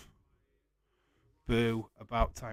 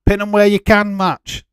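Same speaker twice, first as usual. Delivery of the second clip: announcer hype; 9 semitones higher, 15 dB louder than usual. Acted. Announces silly match name.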